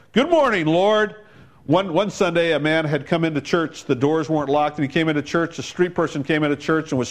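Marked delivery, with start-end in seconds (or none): 0.1-1.2 booming morning greeting, higher and louder; big deep voice. Retold or imitated. Sexton greeting the Lord in a big booming voice. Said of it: "he would say in a big, deep voice".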